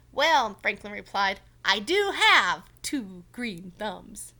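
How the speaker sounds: loud, slow, proud boast; Franklin's proud quip. Acted. Franklin joking to Mister Mole, loud and proud. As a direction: land the joke proudly.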